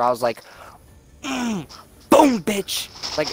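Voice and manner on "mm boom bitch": high, punchy victory cry; hammed-up triumphant exclamation; mock gloating. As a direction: crow over a beaten rival. Acting out his triumph in his head.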